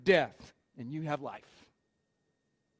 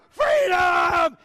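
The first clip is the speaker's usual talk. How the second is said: high, loud rallying scream; battle cry yell; 7 semitones higher, 7 dB louder than usual. Retold or imitated. Braveheart hero rallying the army, battle cry yell. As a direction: bellow it as the charge starts. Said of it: "and then he's".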